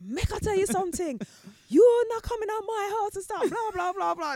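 high, loud scolding tirade; angry Jamaican mum. Retold or imitated. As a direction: scold him hard. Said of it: "She was so angry".

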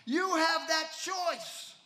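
ordinary talk, nothing marked

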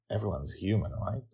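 usual speaking voice

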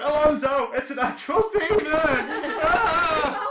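high, panicked shout with laughter; panicked guard yell. Acted. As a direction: scream it in terror, half laughing. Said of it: "yell to the back".

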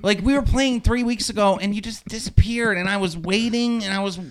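high, pleading imitation of a nerdy kid; desperate nerd. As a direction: mimic an awkward kid desperate for an explanation. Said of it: "you could hear the desperation in his voice".

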